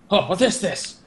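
lightly acted intrigued villain; Scorpius. Acts out Scorpius in retelling.

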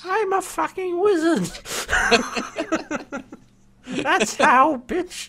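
high, laughing brash wizard; cocky wizard voice. Retold or imitated. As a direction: sneer it like a smug old mage. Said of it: "He doesn't say that, but it's pretty close".

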